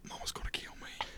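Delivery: hushed unvoiced whisper of dread; whispered panic. Teen locked out, whispering about his mom's wrath. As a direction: whisper it like you're already doomed.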